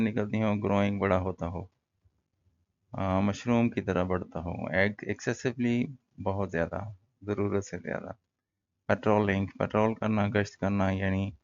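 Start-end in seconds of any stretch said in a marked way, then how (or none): none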